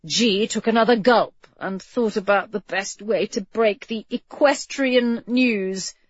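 usual speaking voice

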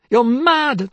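high, loud scream of the same words; shrieked retort. Acted. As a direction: throw it back at her as a scream.

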